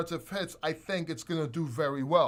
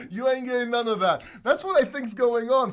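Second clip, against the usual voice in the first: laughing; 4 semitones higher, 6 dB louder than usual. Real, not acted. Still gloating.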